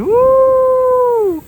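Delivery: high loud whooping; sledding downhill. Real, not acted. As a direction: cheer out loud mid-ride. Sliding down the snow tube.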